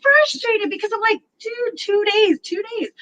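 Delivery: high, exasperated, drawn-out outburst; high slow frustrated rant. Real, not acted. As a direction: vent in disbelief. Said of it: "I was so mad".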